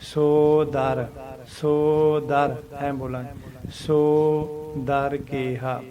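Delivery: ਸੋ held long, pause, then ਦਰ; pause after so. Shown as a demonstration. Teacher showing students the split in ਸੋ ਦਰ, slow and deliberate. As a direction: lengthen ਸੋ and set ਦਰ apart after a gap. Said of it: "ਸੋ ਨੂੰ ਥੋੜ੍ਹੀ ਜਿਹੀ ਲੌਂਗ sound ਦੇਵਾਂਗੇ".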